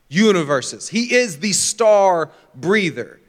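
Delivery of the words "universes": slow breathy exhale into the word; breathing out universes. Retold or imitated. God breathing the stars into existence, slow exhale. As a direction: sigh the word out slowly. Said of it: "God literally went".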